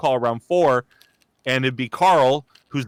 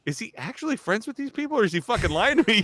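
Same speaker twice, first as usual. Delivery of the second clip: incredulous friend; 9 semitones higher, faster than usual. Retold or imitated. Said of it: "He's like".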